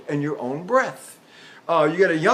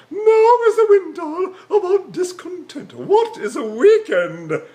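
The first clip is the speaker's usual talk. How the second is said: much higher, loud English stage voice; hammed-up English Shakespearean; 12 semitones higher, 6 dB louder, slower than usual. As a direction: exaggerate a high, pharyngeal English actor declaiming. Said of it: "you keep the words pronounced in the pharynx".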